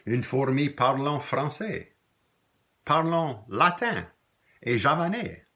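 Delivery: slow, high, emphatic verse; poem recitation. Acted. Teacher reciting a poem about an ant's languages, emphatic poem reading. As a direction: draw out each line, pausing between.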